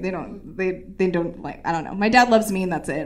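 ordinary talk

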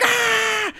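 very high-pitched mock scream; imitated scream. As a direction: yelp out his pained scream. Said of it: "And he's screaming".

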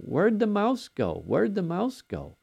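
put-on high, slow little-girl asking; toddler's voice. Grandpa voicing his two-year-old granddaughter asking for the mouse. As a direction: plead like a small child who lost her toy. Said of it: "our little two-year-old is, uh, walking around for a couple of days saying".

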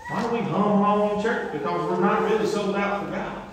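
usual speaking voice

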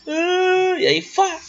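high, loud burst; exclaiming. Acted. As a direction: shout it out, way up high. Describing the moment, exclaiming.

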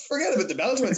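high, loud starstruck brush-off; excited fangirl. Retold or imitated. Fangirl telling her friend about the wrestler, loud excited gushing. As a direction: blurt it out, dazzled by a hero. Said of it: "I was like".

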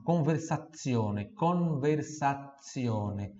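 conversazione stretched very slowly; slow model word. Shown as a demonstration. Teacher introduces conversazione slowly to students. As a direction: draw out conversazione for learners. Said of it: "Vamos para nossas frases de".

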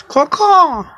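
high, loud crow cawing. Acted. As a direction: caw like a crow. Crow Boss crowing like a crow.

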